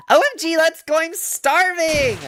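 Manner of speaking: bursting with excitement about food; excited squeal. Acted. Girl excitedly heading for food.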